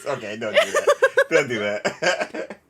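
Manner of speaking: real laughing don't; laughing plea. Real, not acted. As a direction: beg them to stop while laughing.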